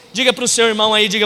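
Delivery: ordinary talk, nothing marked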